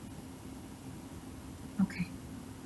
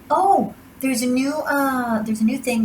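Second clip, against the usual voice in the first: excited; 6 semitones higher, 6 dB louder than usual. Real, not acted. Sudden announcement.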